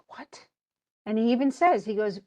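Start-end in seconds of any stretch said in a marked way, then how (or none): 0.1-0.4 breathless, barely voiced "what"; stunned near-whisper. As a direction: whisper it in shock. Oedipus learns he was adopted.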